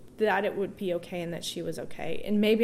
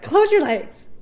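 higher, louder nurses' command; mock urgent order; 10 semitones higher, 10 dB louder than usual. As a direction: bark it like the nurses rushing.

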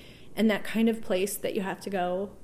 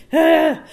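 sharp loud "ah" gasp, higher; startled loud gasp; 8 semitones higher, 14 dB louder than usual. Retold or imitated. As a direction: blurt a startled gasp at the nurse. Reacting to nurse's stare.

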